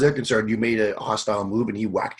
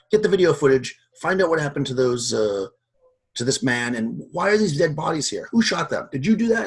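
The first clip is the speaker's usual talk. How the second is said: rising, louder, higher accusation; going at him; 5 semitones higher, 4 dB louder than usual. Acted. Fatma going at the guard, voice climbing with anger. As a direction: lay into him harder with each question. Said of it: "starts kinda going at him".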